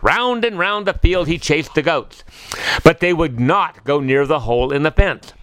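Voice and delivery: ordinary delivery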